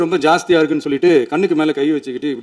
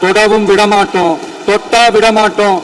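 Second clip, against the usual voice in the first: raised voice; 10 semitones higher than usual. Real, not acted. Leader defying accusers in the assembly, raised voice so they hear. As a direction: raise your voice far above usual, insistent and sustained. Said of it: "நான் ஏன் கொஞ்சம் சவுண்டா பேசுறேன்".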